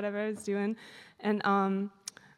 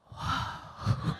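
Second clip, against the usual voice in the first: gasp, then a whispered whoa; an awed, unvoiced gasp and whoa; breathless whoa. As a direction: whisper your amazement on a sharp intake of breath. Amazed at receiving token.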